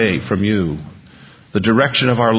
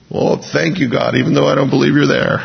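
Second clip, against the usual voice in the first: mock relieved thanks; 6 semitones higher than usual. Teen let off church answering the parents, mock relieved. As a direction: blurt a teasing thank-you, pitched up. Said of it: "I said".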